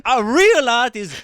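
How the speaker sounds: slow, high, loud theatrical Italian; hammed-up feuding painter; campy Italian artist. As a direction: declaim it like a flamboyant Italian artist.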